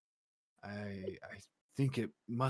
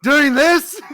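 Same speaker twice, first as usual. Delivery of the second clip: mock parent; 9 semitones higher, 17 dB louder than usual.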